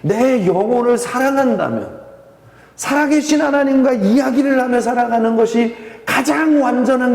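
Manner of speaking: full-force sermon appeal; impassioned preaching. Acted. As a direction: press the church hard with the question.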